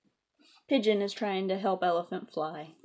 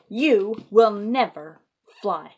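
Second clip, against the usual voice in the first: slow and louder on you will never; stern no; 12 dB louder, much slower than usual. Acted. Elephant firmly telling Piggy no, slow and louder. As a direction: lay down the law, slow.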